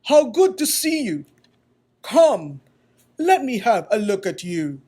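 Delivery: loud, hearty, glad greeting; booming woodcarver greeting; strong voice of the maker. Acted. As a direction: welcome him warmly and fully. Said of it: "The voice was deep and strong".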